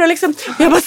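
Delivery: ordinary talk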